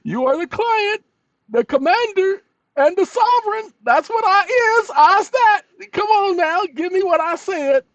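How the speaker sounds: squeaky high mock commander voice.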